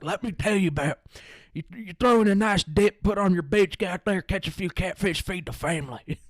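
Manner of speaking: put-on crank up the backwoods country accent; Merle from Walking Dead. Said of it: "talking like Merle from Walking Dead".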